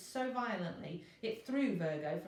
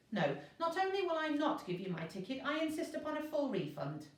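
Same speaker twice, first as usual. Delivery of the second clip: indignant protest; 9 semitones higher than usual. Acted. Virgo demands a refund.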